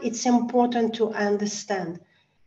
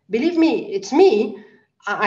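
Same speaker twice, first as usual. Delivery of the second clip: normal talking voice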